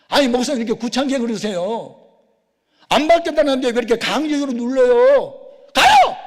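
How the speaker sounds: high, loud exasperated complaint; angry protest. Retold or imitated. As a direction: scold the caller, ending in a shout. Acted anger at repeated doorbell ringing. (화가 나서 높게 소리치는 항의)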